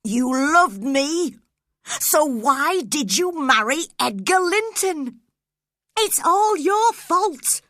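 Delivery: loud, high, slow and pained; anguished outburst. A narrator's reading. Heathcliff blaming Catherine for leaving him, anguished. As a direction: pour out the hurt and blame.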